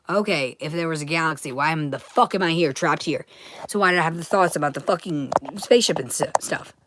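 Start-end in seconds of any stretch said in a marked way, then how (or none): none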